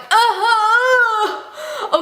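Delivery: high, crying mock agreement; hammed-up weepy assent; tearful whimper. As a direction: wail it through pretend sobs. Acting tearful reply to mom.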